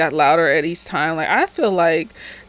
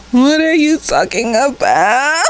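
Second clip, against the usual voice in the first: louder, higher, disbelieving question; incredulous; 6 semitones higher, 4 dB louder than usual. Real, not acted. Recapper reacting to the realtor's excuse, loud and disbelieving. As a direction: ask it in open disbelief.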